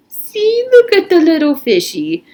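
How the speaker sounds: high, loud mock-cute "fishy", poking fun; teasing big kid. A narrator's reading. Armpit teases Stanley about the fossil fish in mock baby talk.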